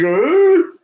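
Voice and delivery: high, loud mock "ooh" for a cartoon ray; a startled little ooh, hammed up. Ray's face in a meme.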